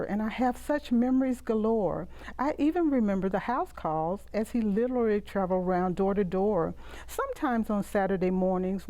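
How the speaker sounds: soft, fond recitation of a rhyming poem; poem reading. Acted. Poet reciting her poem about her doctor father, soft fond recitation. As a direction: read it like a cherished childhood memory in verse.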